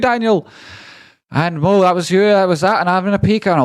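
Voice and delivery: a syrupy, over-the-top thank-you voice reading out donations; gushing high voice. Acted. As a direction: streamer hamming up thanks in a squeaky high voice. Mock sermon thanks, high voice.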